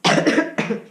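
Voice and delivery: sick rough cough; real cough. Real, not acted. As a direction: cough from a cold. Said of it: "I'm actually a bit sick".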